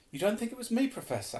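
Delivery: high-pitched, tentative question; hopeful. A narrator's reading. Harry seeks reassurance from his professor, hopeful. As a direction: ask it hopefully, voice lifted. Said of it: "Harry repeated hopefully".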